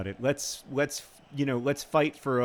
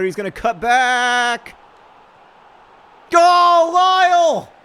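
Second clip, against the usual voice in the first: far higher, much louder shout; frantic cheer; 11 semitones higher, 13 dB louder, much slower than usual. Real, not acted. Gamer cheering his striker, frantic yell. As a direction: scream him toward goal.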